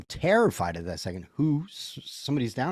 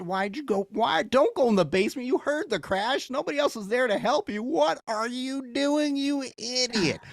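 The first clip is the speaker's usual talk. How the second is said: yelling at the screen; 14 semitones higher than usual. Pretend. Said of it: "the part in the movie where the idiot".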